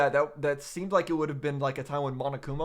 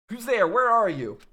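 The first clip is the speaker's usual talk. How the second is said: loud, high, startled calls; alarmed shout; 7 semitones higher, 7 dB louder than usual. Acted. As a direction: call out into the empty room. Voicing a startled character.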